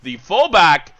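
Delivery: building to a high, full-voiced shout; frantic shouted match commentary; excited play-by-play. Real, not acted. Live call of a goal chance.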